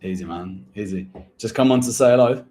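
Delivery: ordinary delivery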